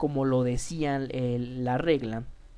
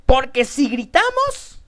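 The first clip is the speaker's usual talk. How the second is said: sudden shout, much louder and higher; 8 semitones higher, 11 dB louder than usual. Shown as a demonstration. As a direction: shout it to show what yelling sounds like. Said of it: "y otra cosa es gritar". (gritando fuerte para mostrar el grito)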